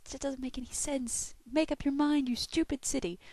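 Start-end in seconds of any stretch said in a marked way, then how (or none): 1.5-3.2 louder, higher mock telling-off of the city; playful rant at the city; mock scolding. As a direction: scold the weather like a naughty kid.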